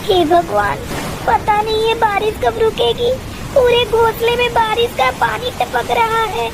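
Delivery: a mother bird fretting as the nest leaks; worried mother bird. Acted. Mother bird to Tuni as the nest leaks, worried.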